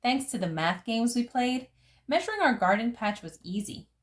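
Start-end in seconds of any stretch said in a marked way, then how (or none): none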